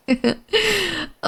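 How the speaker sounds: high, falling little laugh; charmed giggle. Acted. Hostess charmed by her guest's insatiable curiosity, giggling high.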